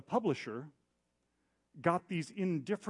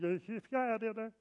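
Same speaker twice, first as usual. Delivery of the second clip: high, breezy brush-off yeahs; fake casual yeahs; 9 semitones higher, slower than usual. Sitting in the staff meeting, brushing off the sting with casual yeahs. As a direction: pretend it doesn't sting, yeah yeah. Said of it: "you know how people, like".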